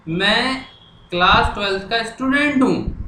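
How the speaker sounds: usual speaking voice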